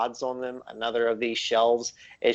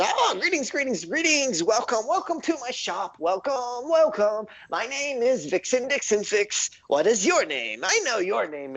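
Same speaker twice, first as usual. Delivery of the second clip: very high, eager gnome voice; excitable gnome; 12 semitones higher than usual. Acted. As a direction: squeak out a giddy little gnome welcome. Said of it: "and the gnome says".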